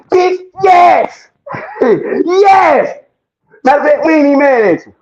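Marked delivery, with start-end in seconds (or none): 0.1-3.0 shouted yes. Real, not acted. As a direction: yell it right back, laughing. Answering "y'all still mad.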